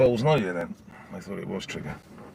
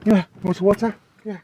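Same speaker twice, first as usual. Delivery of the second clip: high, loud sing-song to the dog; high pet-talk offer of water; 11 semitones higher, 10 dB louder than usual. Real, not acted.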